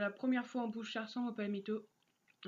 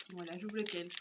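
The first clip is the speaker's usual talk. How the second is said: ordinary talk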